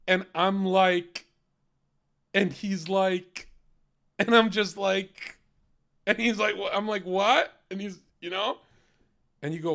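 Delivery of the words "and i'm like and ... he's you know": drawn-out, louder filler phrases; mock female storyteller. Mocking women's two jokes to viewers, drawn-out mock storytelling. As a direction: stretch each "like" out, punchline never coming. Mocks women's pointless joke-telling.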